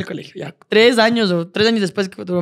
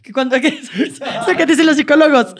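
laughing; 7 semitones higher than usual. Real, not acted. Laughing at own metaphor.

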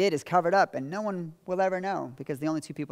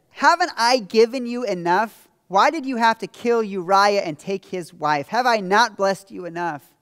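booming, slowed-down rebuke from on high; loud rebuke; 6 semitones higher, 10 dB louder than usual. Acted. Nathan confronts David with God's words, loud rebuke. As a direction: thunder it out as God's reproach.

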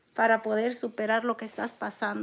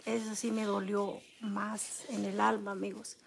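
choked grief; 2 semitones lower, 8 dB quieter than usual. Real, not acted. Mother telling viewers about her son's death, choked up. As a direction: let the pain of losing her son catch the voice. Grieving her son on stream.

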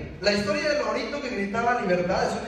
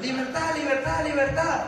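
higher, sing-song parrot cry of "Libertad"; parrot squawk; 5 semitones higher than usual. Retold or imitated. Speaker voicing the caged parrot's cry to the audience, high squawk. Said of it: "¿El lorito?".

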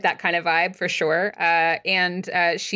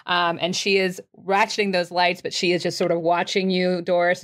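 ordinary talk, nothing marked

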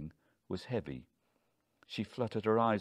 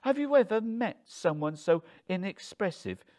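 high, loud, scornful appeal; incredulous, raised-voice jibe; exasperated complaint; 11 semitones higher, 5 dB louder than usual. A narrator's reading. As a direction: appeal to a bystander about your husband, pitched up. Wife mocks husband to Luke.

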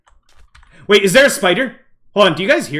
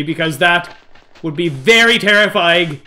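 usual speaking voice

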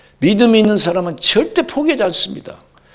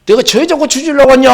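loud rebuff; 7 semitones higher, 12 dB louder than usual. Acted. Preacher voices Jesus rebuffing.

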